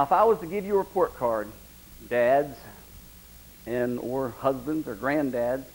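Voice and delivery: ordinary delivery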